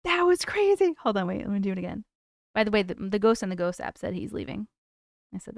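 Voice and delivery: ordinary talk, nothing marked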